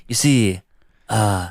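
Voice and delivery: hesitant mock-presidential delivery; lightly put-on politician voice; Donald Trump. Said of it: "start talking in a Trump voice".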